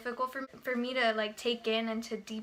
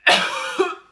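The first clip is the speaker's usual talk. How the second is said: loud hacking cough; 8 semitones higher, 15 dB louder than usual. Real, not acted. Breathless vlogger coughing loudly between points. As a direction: cough hard, really out of air.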